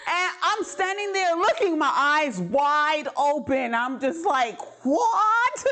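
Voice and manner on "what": a high, loud stunned "What?"; stunned blurt, much higher; shocked. Retold or imitated. Shocked reaction in street.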